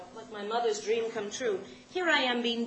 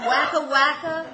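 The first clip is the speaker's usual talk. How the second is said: incredulous repeat; 3 semitones higher, 9 dB louder, slower than usual. Acted. Repeats direction back in disbelief.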